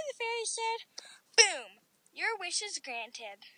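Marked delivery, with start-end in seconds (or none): none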